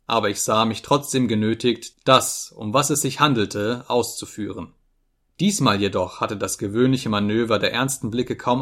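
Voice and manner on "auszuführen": ordinary delivery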